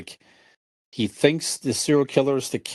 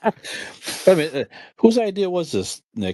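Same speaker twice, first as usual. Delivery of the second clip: laughing teasingly; 7 semitones higher than usual. Real, not acted. To the host about whose idea this was, laughing. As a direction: tease the host while still laughing.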